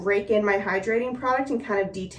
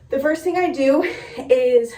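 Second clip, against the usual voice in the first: winded, breathy pushing through words; out of breath; 4 semitones higher, 4 dB louder than usual. Real, not acted. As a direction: talk while short of breath. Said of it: "sorry, I'm a little out of breath".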